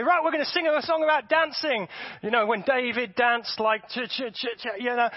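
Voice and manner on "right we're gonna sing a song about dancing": mock worship leader. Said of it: "when they get up and say".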